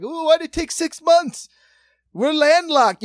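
high, loud mock performer voice; floundering bit, wavering accent. Cast members play off the gag guy, hammed-up shouting. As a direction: imitate hammy improv actors projecting. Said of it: "floundering bits in wavering accents".

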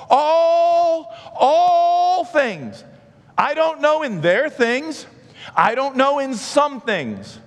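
high, loud, slow preaching, all drawn out; preaching at full force. Shown as a demonstration. Preacher hammering on all for the congregation. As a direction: shout the word all to the rafters. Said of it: "I just need you to believe the word all".

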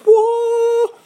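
high, loud awestruck whoa; elated whoa. Retold or imitated. Telling of the trip, a soaring whoa of elation. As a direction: cry out in wonder.

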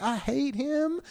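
high-pitched mock Southern aside; Southern backbiting. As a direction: imitate a two-faced Southern gossip. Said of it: "behind their back".

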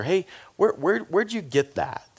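lightly voiced eager asker; nosy admirer. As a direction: play a nosy admirer stumbling over the question. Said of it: "have you ever had somebody ask you".

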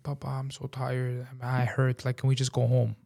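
soft, weary little plea; tired child. Retold or imitated. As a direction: quietly voice a hurting child. Tired, hurting daughter asks to leave.

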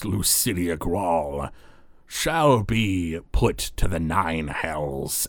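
very slow, drawn-out devil voice; icy devil. Acted. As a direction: deliver the verdict slowly as an icy mantid-like creature. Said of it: "it's that icy devil you saw".

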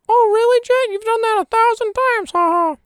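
high, loud squeaky put-on heckler; mocking heckler. Imagined listener teasing the host for repeating himself, mocking. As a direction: jeer in a high-pitched mock voice.

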